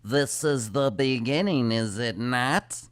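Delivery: slow, solemn otherworldly drone; channeler voice. Retold or imitated. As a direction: intone it like a spirit medium. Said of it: "when they did their channeling with".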